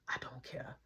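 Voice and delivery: hushed, unvoiced reply; whisper. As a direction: whisper it coldly. Whispered reply outside door.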